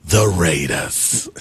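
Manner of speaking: low, slow, drawn-out announcer voice; Chris Berman impression. Doing a Chris Berman impression for a co-host, deep and slow. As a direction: stretch out the Raiders like Berman. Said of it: "when Myron is previewing this game".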